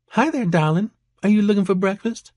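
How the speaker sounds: perky high-pitched Georgia waitress; Georgia accent. A narrator's reading. Waitress greeting a breakfast customer, perky Georgia accent. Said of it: "an accent that was distinctly from Georgia".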